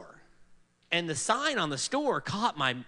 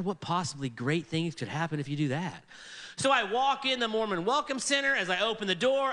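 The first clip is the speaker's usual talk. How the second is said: ordinary talk, nothing marked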